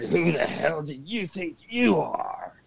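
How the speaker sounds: slow, menacing demon voice, lightly put on. Possessed roommate confronting the speaker, slow mock demon voice. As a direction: drag each word out like something inhuman challenging you. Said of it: "It was like a really deep voice".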